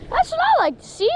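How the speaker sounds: high, delighted cheer; excited. Real, not acted. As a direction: pitch way up with joy. Big catch landed.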